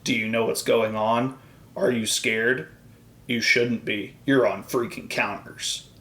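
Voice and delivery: low, hushed dramatic tagline; mock TV tagline voice. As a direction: intone it like a cheesy show announcer. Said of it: "they have this big catchphrase".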